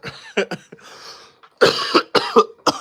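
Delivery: loud high breathy cackle; loud burst of laughter. Real, not acted. Cracking up at his own joke, loud laugh. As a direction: let out a big wheezing laugh.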